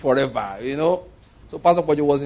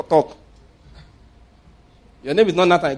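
ordinary talk, nothing marked

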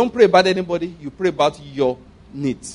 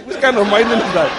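loud, chuckling retort; amused worker waves the question away; laughing retort; 3 semitones higher, 6 dB louder than usual. Retold or imitated. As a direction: laugh at the very idea. Workers react to dollar payment.

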